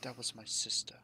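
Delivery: hushed whispered guess; whispering. Real, not acted. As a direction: whisper it like a secret. Player guesses plot twist.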